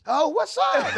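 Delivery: very high-pitched, matching a fan's glee; hammed-up delighted hello back; excited greeting.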